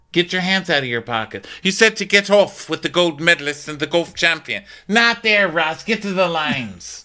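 high-pitched mock scolding of a deckhand; exasperated scolding. Captain ordering Ross to the lines, acted exasperation.

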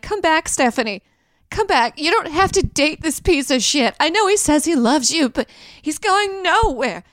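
pleading. Pretend. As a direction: beg her to come back.

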